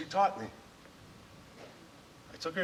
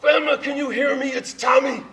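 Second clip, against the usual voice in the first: calling out; 13 semitones higher, 8 dB louder than usual. Retold or imitated. Calling to dying grandmother.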